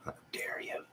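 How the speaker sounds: hushed, playful how-dare-you; mock-offended whisper. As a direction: whisper it with pretend outrage. Mock offense at a chat laugh.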